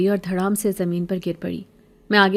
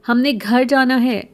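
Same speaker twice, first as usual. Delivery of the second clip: louder, higher shout of defiance; defiant shout; 5 semitones higher, 5 dB louder than usual. Acted. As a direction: yell it as you throw. Boy hits beggar with slipper. (Rashid بھکارن کو چپل مارتے ہوئے، چیخ کر)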